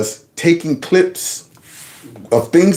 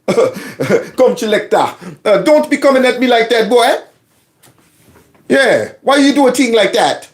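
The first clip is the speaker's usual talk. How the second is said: usual speaking voice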